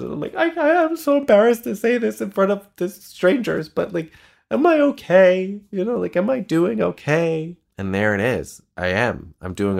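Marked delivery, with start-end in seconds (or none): 0.3-7.6 high, strained plea through tears; teary, pitched-up retelling of asking if she's okay; retold tearful plea. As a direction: relive begging for reassurance while crying. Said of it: "I'm, like, sobbing".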